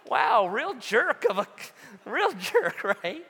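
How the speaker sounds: mock outrage through laughter; sarcastic laughing. Pastor to the congregation, mocking his own reply with laughing sarcasm.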